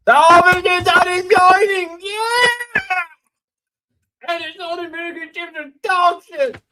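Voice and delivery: shrill mock outrage at top pitch; high squealing rant. Streamer complains to chat about stale chips in a squealing voice. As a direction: squeal it out like a cartoon tantrum.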